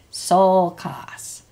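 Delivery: "sol" strong, "kas" trailing lighter; stress on SOL. Shown as a demonstration. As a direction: hit SOL, let "kas" drop. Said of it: "keep the stress on the sol".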